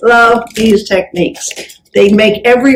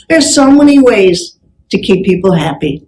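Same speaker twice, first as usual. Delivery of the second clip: ordinary talk, nothing marked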